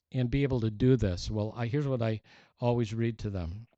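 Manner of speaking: ordinary talk, nothing marked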